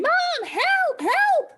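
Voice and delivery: very high-pitched cries for help; mock screaming. Child up a tree screaming for mom, playfully imitated. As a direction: call out high like a stranded child. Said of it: "I'm screaming".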